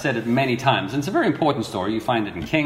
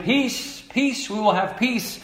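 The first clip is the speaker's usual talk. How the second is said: mock reassurance; 8 semitones higher, 4 dB quieter, slower than usual. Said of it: "false prophets were going around and saying".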